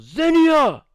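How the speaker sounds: loud high yell of a name; sudden screamed name; screaming a name. Retold or imitated. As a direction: bellow the name across the lot. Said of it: "and screams".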